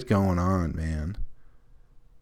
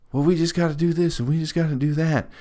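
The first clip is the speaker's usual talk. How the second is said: higher, sing-song dog-talk voice; 8 semitones higher than usual. Retold or imitated. Mom talking to her son as if to a dog, in higher sing-song voice. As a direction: imitate mom cooing at the dog. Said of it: "she talks like everybody's like a dog".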